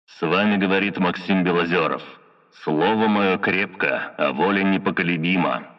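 solemn proclamation through a loudhailer; band-limited loudhailer speech; megaphone. A narrator's reading. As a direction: announce yourself formally over a megaphone. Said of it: "взял мегафон".

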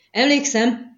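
loud, high desperate yell; boy yelling. A narrator's reading. A frightened boy yells at his interrogator to avoid the lash. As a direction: cry it out to stop the whipping. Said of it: "ordított fel a fiú".

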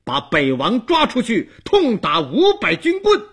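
enraged, loud repeated order climbing high; stamping angry shout. A narrator's reading. Dong Wang yells at guards afraid to act, stamping in fury. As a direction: yell it at hesitant guards, demanding obedience. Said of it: "顿脚喝道".

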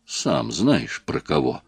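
quiet, knowing menace; hint darkly, voice held low; mysterious threat. A narrator's reading. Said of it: "загадочным и угрожающим тоном".

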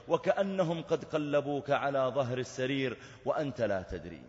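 normal talking voice

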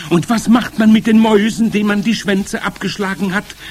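high, loud crowd question; eager witch audience. A narrator's reading. Witch audience asking about the mice, eager. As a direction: pipe up loudly and eagerly.

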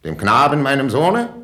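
loud, rising disbelief; incredulous question. Acted. King asks if the sacrifice was for his son, incredulous. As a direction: climb sharply in pitch, stung and incredulous.

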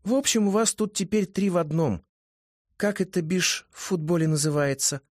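put-on high, chatty girl voice; a lively young woman; high female voice. A narrator's reading.

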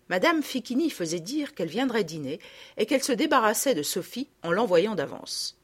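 ordinary delivery

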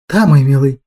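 plain reading voice

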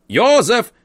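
loud, high call. A narrator's reading. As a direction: call him across the hall. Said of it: "снова окликнул дядя".

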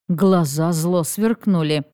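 ordinary delivery, nothing marked

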